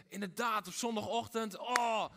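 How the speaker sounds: mock-heroic churchgoer pumping himself up; Sunday bravado. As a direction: swagger in like a hero with a sword. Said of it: "Dat we denken".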